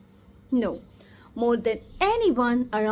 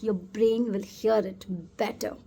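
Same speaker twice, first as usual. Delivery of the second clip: ordinary talk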